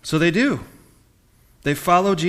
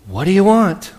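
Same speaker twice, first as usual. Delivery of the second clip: higher and louder, demanding; firm, pushy tone; 6 semitones higher, 6 dB louder than usual. Shown as a demonstration. Jesus confronting his followers, louder and demanding. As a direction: press them for an answer. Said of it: "Was it".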